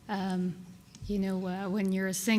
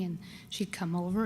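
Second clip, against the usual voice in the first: normal talking voice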